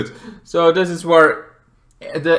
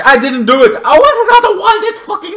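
panicked denial; 7 dB louder than usual. Retold or imitated. Caught Flag Smasher denies killing.

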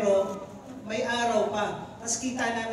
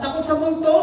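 mock frightened cry; 9 semitones higher, 9 dB louder than usual. Preacher mocks grown men's fear.

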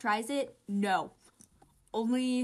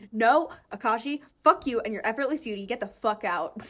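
louder, fast, hammed-up furious shout; mock angry yell; 8 dB louder than usual. Oikawa yelling at Akashi to get out, mock angry. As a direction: snap at him in jealous rage. Voicing Oikawa in acted skit.

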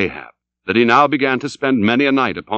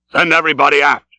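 louder captain's command; firm, raised commanding voice; captain's order; 3 semitones higher, 4 dB louder, faster than usual. Acted. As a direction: call the order out across deck. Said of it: "repeated Ahab".

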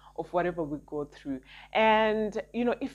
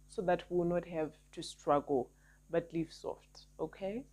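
fading to soft; 8 dB quieter than usual. Real, not acted. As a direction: voice fades off to near silence. Closing softly to viewers.

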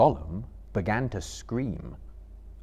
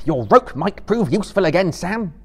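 loud, high-pitched call to a companion; urgent call; 10 semitones higher, 11 dB louder than usual. A narrator's reading. Frodo to Sam after grabbing Gollum, urgent call. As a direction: call out forcefully mid-struggle. Frodo calls out during capture.

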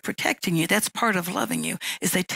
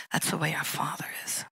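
whisper; 3 semitones lower, 8 dB quieter than usual. Real, not acted. Hushed closing line.

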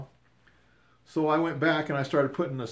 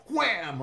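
sharp high "wham" for the hit; mock impact "wham"; 8 semitones higher than usual. Retelling the tree hit, a sudden high "wham". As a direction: blurt the crash of a head on a branch.

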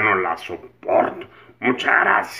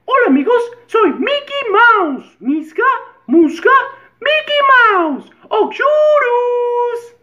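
very high squeaky falsetto; tiny cartoon mouse squeal; Mickey Mouse voice; 9 dB louder, slower than usual. (voz de ratón muy aguda en falsete)

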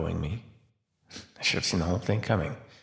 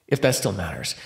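normal talking voice

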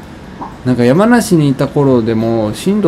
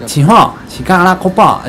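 fake Chinese; 6 semitones higher than usual. Shown as a demonstration. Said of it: "中国語っぽく喋るの得意なんですよ".

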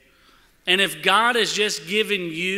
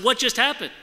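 alarmed question; 7 semitones higher, 5 dB louder than usual. Retold or imitated. Retelling a shocking accident.

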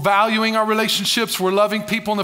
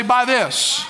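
congregation call-back; 3 semitones higher, slower than usual. Shown as a demonstration. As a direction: lead the crowd in saying it back, slowly. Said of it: "Everybody say".

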